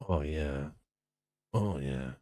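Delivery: low soft gloat. Pretend. Low murmur.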